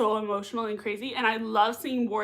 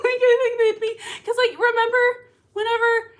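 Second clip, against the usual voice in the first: giggling; 11 semitones higher, 6 dB louder than usual. Real, not acted. Giggling about fan couple.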